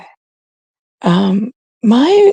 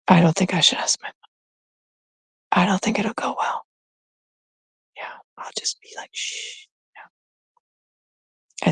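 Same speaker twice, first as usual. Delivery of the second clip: secretive whisper; 8 dB quieter, slower than usual. Real, not acted. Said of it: "secretive about mom".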